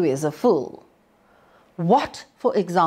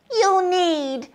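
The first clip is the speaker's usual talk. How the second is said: piglet; 12 semitones higher than usual. A narrator's reading. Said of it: "pig said".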